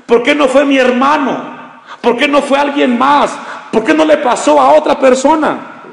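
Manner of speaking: high, pleading "why me"; complaining believer. Acted. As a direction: whine it like a suffering churchgoer. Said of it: "Y tú te puedes preguntar".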